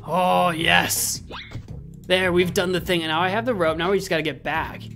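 ordinary delivery

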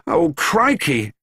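slow, high groan of dismay. A narrator's reading. Jonathan seeing trouble waiting for Dad, groaning. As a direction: groan it out slowly, sensing trouble. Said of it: "groaned Jonathan".

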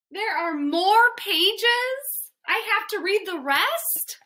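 high, incredulous questioning; dismayed disbelief. Acted. Reader dismayed at more pages.

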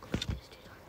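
hushed whisper so nobody hears. Real, not acted. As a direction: whisper it while hiding. Hiding, repeats it in whisper.

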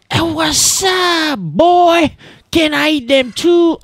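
very high-pitched mock shout; high silly voice. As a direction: squeal it out loud and high, playfully. Hails creature in silly put-on voice.

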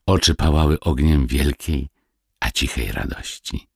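ordinary delivery

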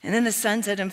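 ordinary talk

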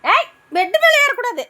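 high, loud shout; sharp, high-pitched warning shout; shouted scolding. Real, not acted. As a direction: yell at a pet to stay off. Shouting pet off the bed.

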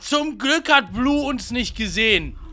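loud, high relieved yell; excited shout. Real, not acted. Near escape from enemy.

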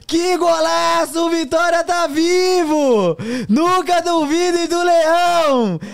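goal call building to a screaming GOL; narração de gol explosiva. Pretend. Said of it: "depois vai ser sem narração pra você narrar". (narração de gol explosiva, gritando gol)

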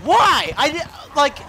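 high, loud angry shout; furious yell. Real, not acted. Gamer dying in match, screaming in fury. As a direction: scream at the game. Rage after death.

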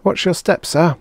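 high sing-song service voice; sweet stewardess. Acted. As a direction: say it sweetly while shoving. Robot stewardess pushing him.

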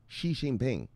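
"Xi Jinping" with Xi said "shee". Shown as a demonstration. Showing viewers the president's name, "Xi Jinping" with sh. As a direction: start "Xi" in "Xi Jinping" with a sh sound. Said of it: "it's pronounced sh".

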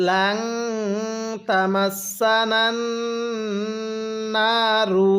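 drawn-out chanted recitation; slow melodic Quranic chant. Shown as a demonstration. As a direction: recite it like a tajweed teacher. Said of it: "পড়ুন".

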